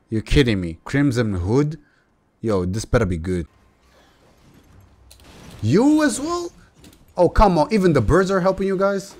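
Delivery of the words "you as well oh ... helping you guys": high, loud incredulous whine; exasperated disbelief. Real, not acted. Gamer facing yet another enemy, high incredulous protest. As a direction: protest at the unfairness. Gamer outraged by more enemies.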